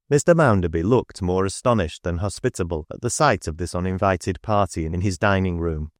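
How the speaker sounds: usual speaking voice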